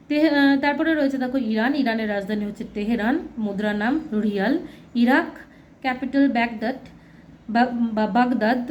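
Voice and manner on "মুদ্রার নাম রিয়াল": ordinary talk, nothing marked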